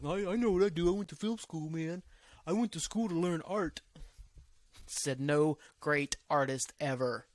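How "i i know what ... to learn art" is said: higher-pitched put-on voice of a self-important critic; mock film-school YouTuber. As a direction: mimic a film-school know-it-all, pitched up. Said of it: "he can critique it".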